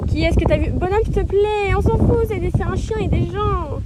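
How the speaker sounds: loud, high telling-off; scolding shout. Real, not acted. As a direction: call the horse to order. Rider scolding horse loudly.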